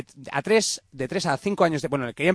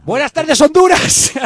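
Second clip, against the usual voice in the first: joking shout; 13 semitones higher, 10 dB louder, slower than usual. Acted. Joking shouted greeting to listeners.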